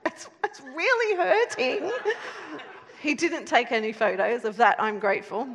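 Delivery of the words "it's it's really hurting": high, laughing, mock-tearful whimper; a touch of retold panic; mild imitated crying. Wife hanging through the ceiling, mildly mock crying. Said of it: "I'm crying and panicking".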